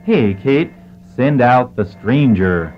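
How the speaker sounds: ordinary delivery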